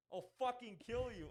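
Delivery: his character's voice line, a hammed-up yell; mock angry voice line. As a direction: ham up the character's scripted snarl. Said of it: "my guy goes".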